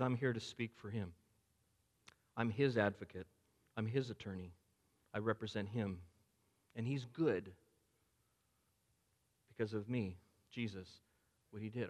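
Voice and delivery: soft, tender reassurance; gentle Jesus. Acted. Jesus speaks as his advocate.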